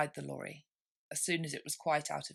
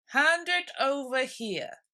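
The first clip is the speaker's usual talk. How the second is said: slow, loud, higher; dodo command; 8 semitones higher, 7 dB louder, slower than usual. A narrator's reading. Dodo ordering Alice, slow loud demand. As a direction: demand it grandly and slowly. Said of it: "said the dodo".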